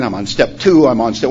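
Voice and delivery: usual speaking voice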